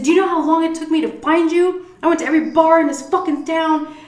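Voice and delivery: loud, high-pitched scolding tirade; angry wife act. Acted. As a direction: play a furious wife chewing out her husband in public. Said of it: "I was acting like his wife".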